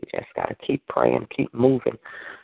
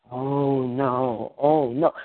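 mock dismay; much slower than usual. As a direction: imitate someone dreading bad news. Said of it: "stop looking at things like".

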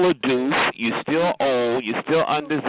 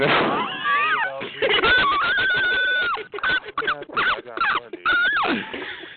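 very high, screaming laugh; long shrill scream of a laugh; shrieking laugh. Real, not acted. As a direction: let out a piercing howl of laughter.

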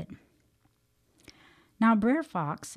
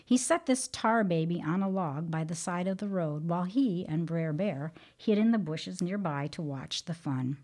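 usual speaking voice

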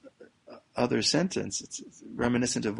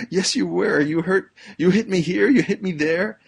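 high, louder mock kid complaining; whiny victim; 6 semitones higher, 5 dB louder than usual. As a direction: tattle like a hurt little boy. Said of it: "the little boy, the victim, says".

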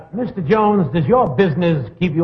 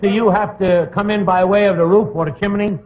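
normal talking voice

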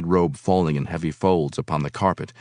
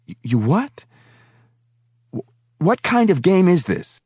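higher, louder stammered alarm; stammering shock; 11 semitones higher, 4 dB louder than usual. A narrator's reading. Man learning she lied, stammering high alarm. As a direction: trip over the words in shock.

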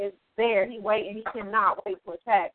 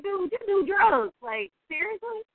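exasperated; 10 semitones higher, slower than usual. Retold or imitated. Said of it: "I'm like".

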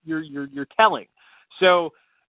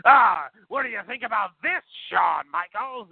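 riled-up interviewer, put-on voice; Vince McMahon. Impersonating a wrestling boss interviewing people, riled up. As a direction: bark out an irate interview question. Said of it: "get his Irish up and he'd be like".

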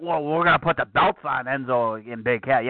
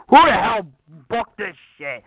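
higher, louder furious demand; angry demanding boss; 7 semitones higher, 4 dB louder than usual. Acted. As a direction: demand answers from staff. Angry boss demands who booked.

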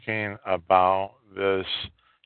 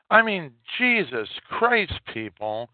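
exasperated outburst; 6 semitones higher than usual. Real, not acted. Ranting at people about things getting worse, exasperated. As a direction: pitch jumps way up in exasperation.

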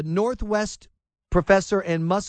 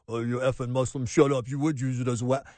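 imitating rude passenger; 7 semitones lower, faster than usual. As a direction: sneer it out like the bigot in the next row. Host retells rude passenger's taunt.

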